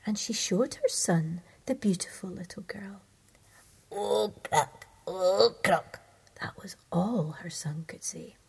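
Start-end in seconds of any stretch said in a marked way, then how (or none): 3.9-4.9 dull toad croak, slow and falling. Acted. Toad son answering his mother with a croak. 5.1-6.0 louder, rasped toad croak. Acted. Toad son croaking again at the bride, louder.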